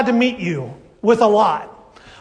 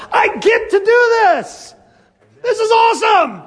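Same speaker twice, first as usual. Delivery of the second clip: excited shout; 11 semitones higher, 3 dB louder than usual. Retold or imitated. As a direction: burst out with eager delight. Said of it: "we should be coming at it with a".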